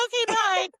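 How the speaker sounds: high, bright hurried bye; high chipper bye. Retold or imitated. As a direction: chirp it and hang up. Cheerful quick hangup.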